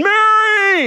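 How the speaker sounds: high shouted call; screaming her name. Retold or imitated. People on the boat screaming for Mary, high. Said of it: "hears the people screaming".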